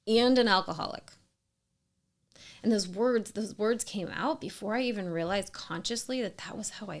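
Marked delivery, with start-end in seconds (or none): 0.1-1.1 loud, cutting final blow; a wife ending the fight with an insult; angry insult. Retold or imitated. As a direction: spit out the last insult in fury. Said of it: "And I ended it with".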